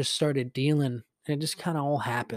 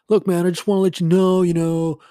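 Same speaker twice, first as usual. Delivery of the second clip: loud, higher, hurried protest; imitated freak-out; 5 semitones higher, 9 dB louder than usual. Host grabbing the mic to complain, loud imitated freak-out. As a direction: mimic a worked-up host on the mic. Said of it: "they're, like, freaking out".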